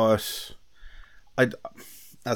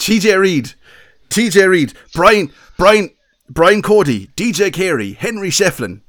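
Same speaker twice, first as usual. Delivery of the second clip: loud, high-pitched mock Kilkenny accent; exaggerated Kilkenny accent, every name belted; 7 semitones higher, 9 dB louder than usual. Shown as a demonstration. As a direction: reel off names in hammed-up Kilkenny. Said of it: "here's my best Kilkenny accent".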